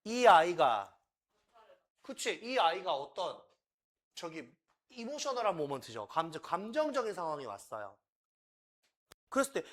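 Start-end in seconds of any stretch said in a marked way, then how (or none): none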